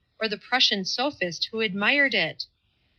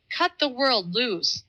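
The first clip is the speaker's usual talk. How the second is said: higher, slow and fierce; savage cry; 5 semitones higher than usual. Acted. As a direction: fling the words out savagely. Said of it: "he cried with a savage gesture".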